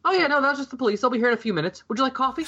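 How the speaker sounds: high, airy and breezy; breezy matter-of-fact. Retold or imitated. Imitating Diane brushing off the police, breezy. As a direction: brush it off lightly. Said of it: "she plays it off perfectly".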